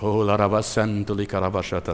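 low, soft murmured adoration; worshipful tongues. Shown as a demonstration. Preacher showing how one worships God in tongues, hushed and reverent. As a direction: let the syllables flow softly and low. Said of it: "wenn du Gott anbetest".

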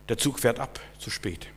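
breathy whispered warning, fading away. Acted. Preacher warns the congregation in a whisper. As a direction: hush it like a final warning.

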